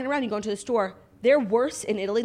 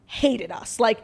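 ordinary talk

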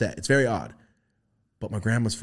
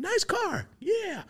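mock grandpa; much slower than usual. Grandpa quoted high.

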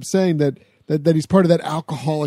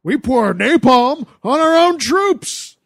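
put-on high, slow drunken ranting; drunken Hal Holbrook; 8 semitones higher, 4 dB louder, slower than usual. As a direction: bellow like an outraged drunk dad. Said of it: "Hal Holbrook gets fucking piss ass drunk".

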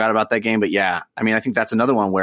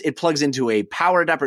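normal talking voice

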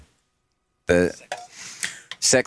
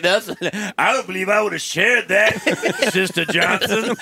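laughing; 11 semitones higher than usual. Retold or imitated. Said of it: "I'm like".

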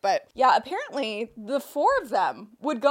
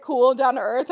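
ordinary talk

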